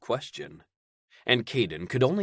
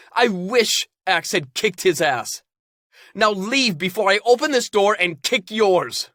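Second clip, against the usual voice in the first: high, loud angry rant; furious yelling; 12 semitones higher, 8 dB louder than usual. Acted. Caden yelling at his parents so outsiders hear. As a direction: shout him down, furious. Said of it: "Caden screamed".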